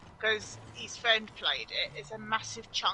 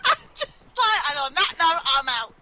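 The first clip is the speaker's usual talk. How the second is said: laughing; 8 semitones higher, 11 dB louder than usual. Real, not acted. Laughing exit line.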